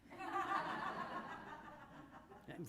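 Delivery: high drawn-out laugh; long bright laugh. Real, not acted. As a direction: laugh in happy disbelief. Laughs at going home.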